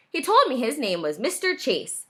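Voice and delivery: ordinary delivery, nothing marked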